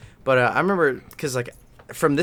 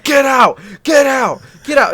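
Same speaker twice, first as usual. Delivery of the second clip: loud, high repeated yells; retold panic, each order shouted high; freaked-out shouting; 11 semitones higher, 10 dB louder, slower than usual. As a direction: re-enact frantically driving someone out. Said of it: "I started freaking out".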